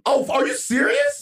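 slow, very high-pitched disbelief; incredulous surprise. Real, not acted. Host hears Morgan Freeman really is in the movie, incredulous surprise. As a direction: draw the question out high, truly astonished. Bluffed guess confirmed by co-host.

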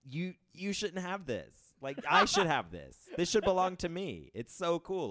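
high, drawn-out mock indignation; covetous whine. Telling a friend the cool things should be mine, covetous. Voices envy at friend's collection.

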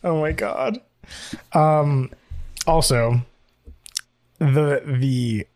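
ordinary talk

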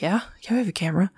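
fast breathless whisper; quick whisper. Retold or imitated. Ghost hunters whisper back to the curious driver, fast and hushed. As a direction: hiss it out quickly, barely voiced.

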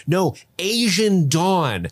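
high, drawn-out insistence; imitating an exasperated man on the phone; exasperated phone caller. Acting out phone quote from film.

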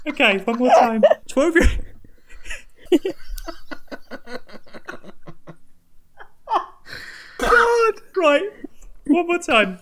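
trying to compose yourself through giggles; real laughter fading; still laughing. Real, not acted. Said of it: "I'm crying".

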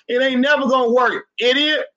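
high, exasperated burst at the idiots; high-pitched heated jab; frustrated outburst. Real, not acted. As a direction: let the frustration boil over. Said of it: "Calm down, boys".

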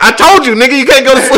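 high and loud, half laughing; mock scolding yell. Friend scolding him for not sleeping, loud laughing yell. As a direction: yell it through a laugh, teasing.

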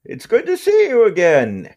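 high, cheery greeting from a slime; slime creature voice. Acted. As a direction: pitch up a cheerful goo creature.

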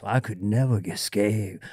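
low, soft character voice reading a line; Psycho Bill voice. Acted. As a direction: drop the voice low and soft for the villain. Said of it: "when you hear that voice".

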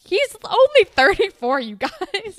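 high, loud exasperated objection; indignant protest. Acted. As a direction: protest loudly on his behalf. Protesting the friends' mockery.

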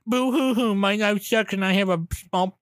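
mock whiny sobbing voice; hammed-up boo hoo crying; fake crying. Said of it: "the fucking guy was all like".

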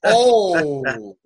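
short startled oh, a touch of mock alarm; imitated gas man's startled oh. Repairman surprised at the pipe. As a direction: mimic a surprised groan. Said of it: "you can hear him go".

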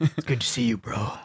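gruff, understated tough guy; Snake Plissken. Shown as a demonstration. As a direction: keep it gruff, like a terse action hero. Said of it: "like, Snake Plissken gruff dude".

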